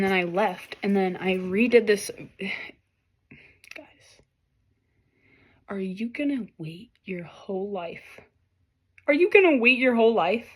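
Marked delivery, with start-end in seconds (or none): 2.3-8.2 hushed whisper to the viewers. Real, not acted. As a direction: lean in and whisper the question. Tarot reader whispers to viewers.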